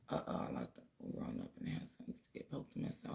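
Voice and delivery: unvoiced whispered aside. Real, not acted. Speaker whispers an aside about grandpa poking people. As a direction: drop to a breathy secret whisper.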